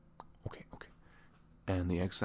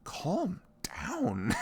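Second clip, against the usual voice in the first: high, louder mock exasperation; exasperated calm down; 11 semitones higher, 5 dB louder than usual. Boyfriend mocking online extremists to his girlfriend, exasperated. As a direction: wave off the online extremists, half-laughing. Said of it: "I'm just like".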